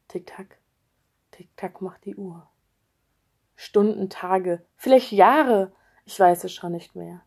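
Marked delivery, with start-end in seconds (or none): 0.1-2.5 a soft ticking whisper. Acted. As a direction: whisper the clock. Clock ticking, losing time. (tickend geflüstert)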